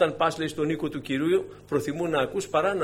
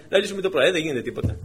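usual speaking voice

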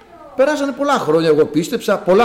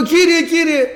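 loud, high plea to God; re-enacted urgent call, pitched well up; retold crying out; 12 semitones higher, 5 dB louder, slower than usual. Father calls on the Lord for his dying child, retold crying out. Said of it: "φώναζα εγώ".

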